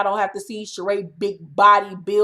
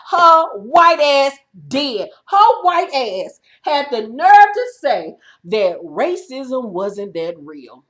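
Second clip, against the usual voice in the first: drawn out, each word hammered; slow emphatic outrage; 2 semitones higher, 5 dB louder, slower than usual. Real, not acted. Vlogger condemning Kim's remark, slow disgusted emphasis. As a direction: spell it out slowly in disgust.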